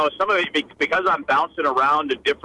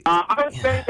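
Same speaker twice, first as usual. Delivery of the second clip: put-on Irish accent; 11 semitones higher than usual. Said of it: "In a better accent".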